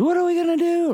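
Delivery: high, frantic mock panic; hammed-up panicked bystander; panicked cry. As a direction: squeal it like someone losing their mind. People freaking out at accident.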